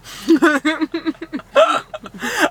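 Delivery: high amused laugh. Real, not acted. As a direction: burst out laughing. Teasing friend's admission.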